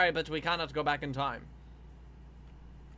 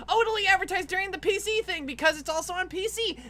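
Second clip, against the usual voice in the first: high, eager self-parody; mock hopeful guessing; 5 dB louder than usual. Mocking own hopes before E3, high eager voice. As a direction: voice naive hope way up high.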